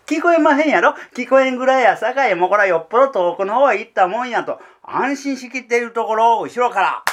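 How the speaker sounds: high, loud and eager; pleased with his own plan; excited. Real, not acted. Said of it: "そんなアホなことあるか". (得意げに、高く大きな声で)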